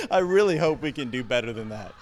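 words coming out through laughter; laughing. Real, not acted. Pastor amused by the congregation's minion attempt, laughing. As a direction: say it while still chuckling.

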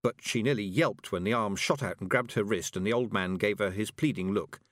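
plain reading voice

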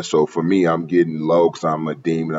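ordinary talk

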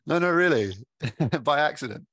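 laughing. Real, not acted. Host cracking up at the story.

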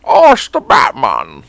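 hammed-up Bane voice; Bane impression. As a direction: loom over the line like a masked brute.

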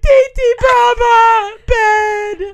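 high, loud tearful chant; mimicked tantrum cry; demanding toddler imitation. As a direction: wail each demand like a toddler.

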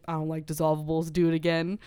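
lightly voiced low doctor; attending doctor. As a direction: drop the pitch for an offhand doctor's order.

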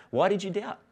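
normal talking voice

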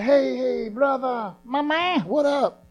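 street greeting. Pretend. Imagined greeting at a stranger's door.

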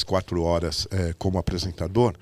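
usual speaking voice

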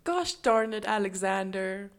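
loud, drawn-out mock American scolding; mock scolding parent. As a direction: play an exasperated American parent.

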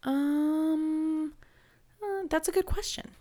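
high, hesitant, caught-out voice; stumped. Retold or imitated. Answering her son's sharp question, high and stumped. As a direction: stall as if caught off guard. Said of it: "And I'm like".

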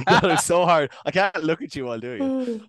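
ordinary delivery

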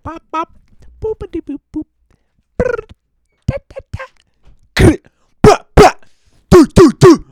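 silly beatbox noises to fill time; mouth sound effects. Pretend. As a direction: pop out goofy vocal sounds. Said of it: "Make sounds".

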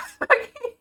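high-pitched burst of laughter; high giggle. Real, not acted. Vlogger giggling at her own apology to God. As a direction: giggle helplessly. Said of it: "I'm laughing at it".

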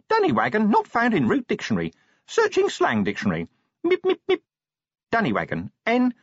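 high-pitched imp reciting a dictionary entry; officious imp. Acted. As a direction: rattle off the lookup as a little imp. Imp reads out dictionary search.

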